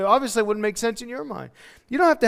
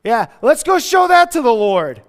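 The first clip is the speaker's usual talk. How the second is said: mock sarcastic Thomas, a bit louder; hammed-up scoffing sarcasm; sarcastic doubter; 4 semitones higher, 7 dB louder than usual. Doubting Thomas mocking the tiny lunch, sarcastic. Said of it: "He's probably like".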